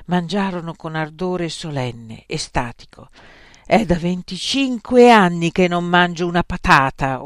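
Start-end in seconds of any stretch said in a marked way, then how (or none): 3.7-7.2 loud, pointed remark; mildly threatening declaration; vaguely menacing. A narrator's reading. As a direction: say it with a hint of threat. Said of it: "in tono vagamente minaccioso".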